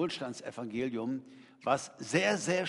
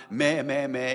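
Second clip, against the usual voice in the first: mock sheep bleating meh; 7 dB louder, slower than usual. Preacher playing the sheep, bleating meh. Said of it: "ich bin sein Schaf".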